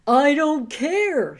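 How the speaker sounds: loud, slow snarl; snarling wolf. A narrator's reading. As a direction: snarl it at the lamb. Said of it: "snarled the wolf".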